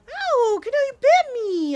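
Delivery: sharp, high, loud cry of pain and surprise; real startled yelp. Real, not acted. Bitten by her cat Kudo, loud startled yelp. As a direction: yelp as the cat's teeth sink in.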